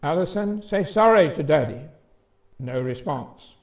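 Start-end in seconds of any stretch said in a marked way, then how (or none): 0.0-1.8 sorry pushed louder, higher on Alison; firmer, louder repeat of the order. Retold or imitated. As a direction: raise the voice, a big sister losing patience. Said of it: "in a louder voice".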